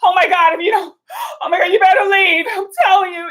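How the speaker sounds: high, loud, whimpering fake-crying plea; hammed-up sobbing panic; mock panicked plea. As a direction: ham up a terrified homeowner. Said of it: "you're like".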